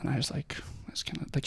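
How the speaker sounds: unvoiced, hushed retelling; whisper. Real, not acted. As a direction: drop to a breath as the call comes. Recalling the scary phone call.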